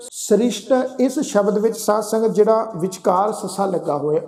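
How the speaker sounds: ordinary talk, nothing marked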